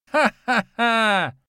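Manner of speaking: high, loud, slow crazed cackle; unhinged villain laugh; mad laugh. A narrator's reading. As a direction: cackle like a madman.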